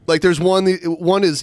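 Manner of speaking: normal talking voice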